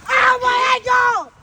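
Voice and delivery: high, loud fake ankle yell; tripping and falling. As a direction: scream like you twisted your ankle. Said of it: "we're just gonna yell as loud as possible".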